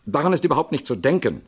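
firmly. A narrator's reading. Actor rejecting any recasting, firm and fast. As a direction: shut the idea down without room for doubt. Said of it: "erklärte Norman Knight bestimmt".

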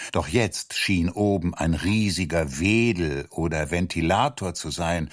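ordinary delivery

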